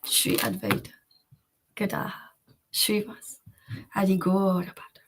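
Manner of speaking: hushed devotional whisper; whispered prayer. Real, not acted. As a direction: breathe the names in a whisper.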